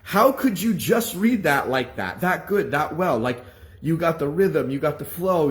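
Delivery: high, loud, incredulous imitated rant; shocked anger. Teacher stunned that the bad reader read well, angry disbelief. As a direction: challenge him loudly in disbelief. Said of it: "pissed, shocked, and angry".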